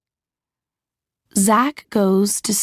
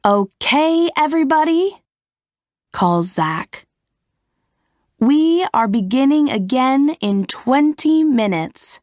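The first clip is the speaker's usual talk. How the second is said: raised, higher director calling across the set; 7 semitones higher than usual. Acted. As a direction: call out the directions like a film director. Director Zach announces a break.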